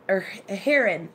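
"heron" said HAIR-un; short, unstressed second syllable in "heron", HAIR-un; friend's HAIR-un pronunciation. Retold or imitated. Quoting the friend's version, "heron" said HAIR-un. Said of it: "That's what she said".

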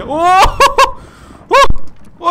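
high, loud scared gasp-shout; real fright cry; startled yelp. Real, not acted. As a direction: jolt at the scare. Jump scare.

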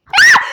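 a sudden, very loud high scream; real jolt of surprise; startled shout. Real, not acted. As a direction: scream out in shock. Sudden shock in game.

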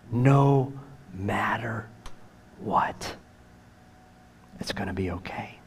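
very hushed whisper, phrase by phrase with long pauses; soft, unhurried, intimate whispering; comforting whisper. Acted. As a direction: whisper reassurance as if holding someone close. Voicing Jesus comforting us, whispered.

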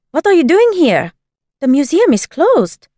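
high, loud startled scolding; startled, indignant. A narrator's reading. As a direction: shoo the intruder out in alarm.

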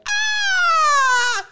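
very high, loud shriek; mock scream. Girlfriend startled by the eel, high loud shriek. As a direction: shriek like something just jumped at you. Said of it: "you hear my girlfriend go".

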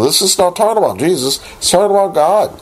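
rising excitement, rushing to the reveal; excited. Real, not acted. Preacher revealing to his congregation it means God, excited. Preacher's emphatic punchline point.

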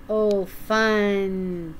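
usual speaking voice

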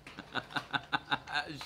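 soft, rapid wheezy giggle; guilty chuckle. Real, not acted. Laughing, though feeling bad.

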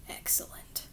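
whispered gloating; villainous whisper. A narrator's reading. Dark Lord gloating to himself in a whisper. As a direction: breathe it like a scheming villain.

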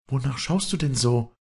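lightly voiced girl, high questioning tone. A narrator's reading. Girl to the staring young farmer, questioning in high voice.

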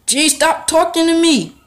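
high, loud exasperated shout; annoyed yell. Real, not acted. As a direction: yell it at the pestering character. Yelling at game character.

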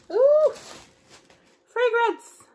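very high, loud squeal; delighted squeal. Real, not acted. Finding a fragrance in the calendar, a delighted squeal. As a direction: shriek with delight at the find.

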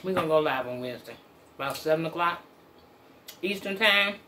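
eating between words; talking while chewing. Real, not acted. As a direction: mouth partly full. Seen 0: Eating meal; recent bite, mouth working, fork raised with food.

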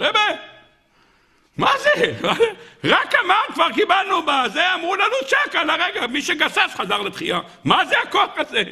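high-pitched amazement; astonished. Acted. Gabbaim marvel to the Baal Shem Tov, astonished outcry. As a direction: exclaim in disbelief, voice way up.

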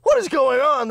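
high, loud startled yell; yelling in shock as the car swerves; panicked shout. Retold or imitated. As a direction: shout it in a panic at the sudden brake. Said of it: "I was like".

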